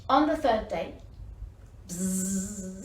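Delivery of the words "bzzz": low, soft buzzing hum; mosquito buzz. A narrator's reading. Mosquito buzzing over the elephant, soft buzz.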